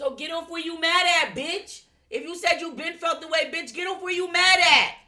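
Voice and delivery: furious shout. Real, not acted. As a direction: yell it at him full force. Heated outburst at the friend.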